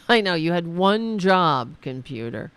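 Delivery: loud exasperated scolding. Real, not acted. Streamer scolding the computer, loudly exasperated. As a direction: scold it loudly, stretching the words.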